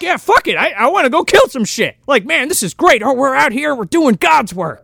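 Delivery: hammed-up happy thug; gleeful henchman. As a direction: play a trooper thrilled to kill. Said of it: "They're so happy to be there".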